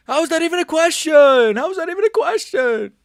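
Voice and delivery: high, loud squeaky outraged voice; put-on voice. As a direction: shriek it in a goofy voice.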